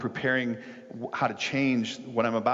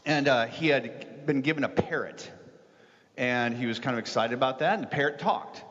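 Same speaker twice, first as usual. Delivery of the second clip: lively, raised-pitch joke telling; higher, animated story narration; animated storytelling; 8 semitones higher than usual. A narrator's reading. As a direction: tell it like a story building to a punchline.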